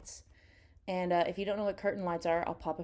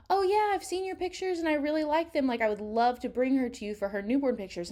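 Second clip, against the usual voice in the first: friend's eager reply; 6 semitones higher, 3 dB louder than usual. Retold or imitated. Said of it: "she was like".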